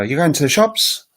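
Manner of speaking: high Australian uptalk, soaring at the end; Australian question rise. Shown as a demonstration. Teacher imagining she was in Australia, Australian high rising question. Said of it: "if I was in Australia".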